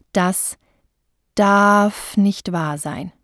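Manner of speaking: a pause after Das, then darf drawn out slowly; pause and stretch. Shown as a demonstration. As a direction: hold a beat before darf and stretch it. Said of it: "vor dem Wort eine Pause machen oder das Wort dehnen".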